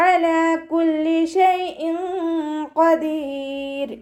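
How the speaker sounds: slow, drawn-out melodic tajweed chant; Quran recitation. Shown as a demonstration. Teacher models melodic Quran recitation for students. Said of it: "അതിൻ്റെ യഥാർത്ഥ sound തന്നെ".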